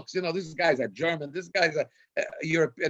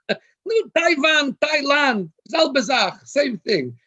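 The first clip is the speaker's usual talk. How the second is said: high, loud, slow mock shout, brushing it all off; dismissive donor; 9 semitones higher, 5 dB louder, much slower than usual. The donor shouts back at the rabbi that Taiwan and Thailand are the same thing. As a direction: play the rich donor waving away Taiwan and Thailand as the same.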